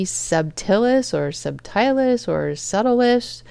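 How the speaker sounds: "subtilis" said slowly three different ways; varied pronunciations. Shown as a demonstration. Vlogger unsure of a bacterium's name, trying "subtilis" in different pronunciations. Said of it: "hearing it pronounced all sorts of different ways".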